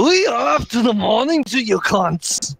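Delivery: deliberately awful Irish greeting; bad accent. Irritating friends on voice chat, bad Irish accent. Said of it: "I know bad accents".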